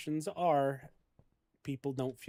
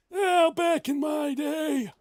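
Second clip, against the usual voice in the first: put-on higher, louder, slow wheezy old man; wheezy grandpa; 6 dB louder, slower than usual. Shown as a demonstration. Streamer demoing a wheezy deathbed grandpa, slow. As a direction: creak it out like a grandpa on his deathbed. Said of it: "wheezy, almost on their deathbed grandpa voice".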